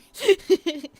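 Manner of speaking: high, loud run of giggles; mischievous giggle. Real, not acted. Creature banned from the touch tank, giggling. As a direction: giggle away at your own mischief.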